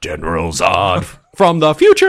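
loud, laughing announcement; co-host laughing at the plot twist, loud and thrilled; excited laughing reveal. Real, not acted. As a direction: blurt it out, delighted and amazed.

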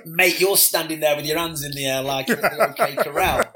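loud teasing through laughter; mock him while laughing; laughing tease. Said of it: "and we said".

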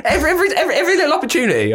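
high, rushed, laughing complaint; laughing exasperation. Real, not acted. Host teasing guest about Brits, laughing exasperation. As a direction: stammer it out fast through a laugh.

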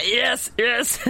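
playful imitation of an excited yes; imitated cheering. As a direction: mimic friends cheering you on. Retells companions cheering her decision.

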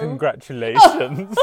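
bursting into laughter on the word; laughing through the quote. Retold or imitated. Quoting Dutch's funeral blunder, laughing. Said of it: "and Dutch said".

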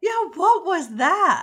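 what was that, utterly baffled; stunned disbelief at the cheap scroll; incredulous. Real, not acted.

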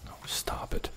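breathy whispered brush-off; whispering. Real, not acted. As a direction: whisper it to yourself. Whispered aside after intro.

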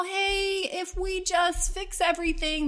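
high, slow coaxing big brother. Retold or imitated. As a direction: talk the sister round, lightly voiced. Brother coaxing sister, voiced higher.

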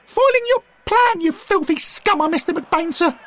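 put-on very high, loud boyish crow; young Derek, triumphant. A narrator's reading. Derek gloats over McBain in the mud, very high and loud. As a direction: crow over the caught traitor.

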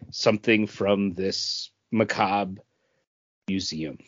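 low, slow creepy trailing voice; mock spooky. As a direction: let it sink into a playful horror tone. Describing spooky comic premise.

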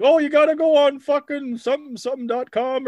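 squeaky, hyped bro pushing a link; high-pitched goofy commenter; high mock bro voice. Spammy commenter pushing fight website.

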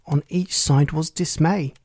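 plain reading voice

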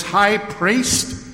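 Paul mocking the high priest's title; cynical mock surprise. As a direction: throw the title back at him, sneering innocence. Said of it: "condemnation by cynicism".

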